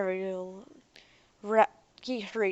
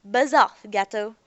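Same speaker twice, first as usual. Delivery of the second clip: loud, contemptuous dismissal; rude brush-off; 2 semitones higher, 11 dB louder than usual. Acted. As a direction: snap him away, much louder. Sullen man rebuffs stranger.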